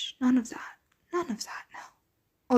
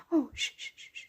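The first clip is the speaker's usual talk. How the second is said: gentle hush; soothing shushing; 4 semitones higher, 5 dB quieter, faster than usual. Acted. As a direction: soothe them quietly. Calming a crying listener.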